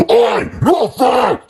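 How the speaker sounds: very loud, slow, high scream. A narrator's reading. Timmy screaming as his robot legs are ripped off. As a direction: drag out a furious shriek.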